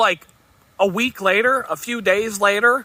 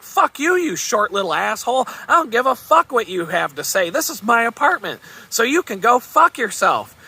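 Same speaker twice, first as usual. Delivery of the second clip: angry yelling; 6 semitones higher than usual. Retold or imitated. The boss yelling insults at Tom Cruise, furious. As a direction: spit it at him with contempt. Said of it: "he starts yelling back".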